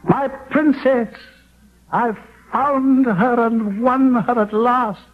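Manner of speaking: high, slow, soaring triumph; elated, exulting. Acted. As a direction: savour the victory, lifting each word up high. Peer exults after winning Solveig.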